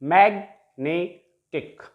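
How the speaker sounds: magnetic slowly split as mag, ne, tic; magnetic, slow with a gap after each syllable. Shown as a demonstration. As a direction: break magnetic into three separate syllables. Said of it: "trisyllabic word".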